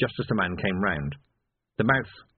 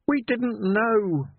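high, very slow, broken; each word dragged out in shock; devastated young constable; 13 semitones higher, much slower than usual. A narrator's reading.

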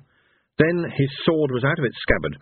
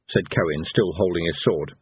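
usual speaking voice

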